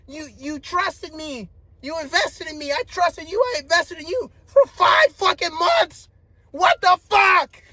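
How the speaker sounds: high-pitched hurt, building to a shout; retold betrayed outburst. Man confronting her after five months of trust, building to outraged shout.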